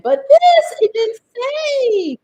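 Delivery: real indignation; indignant. Real, not acted. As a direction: burst out in indignation. Indignant about instructions.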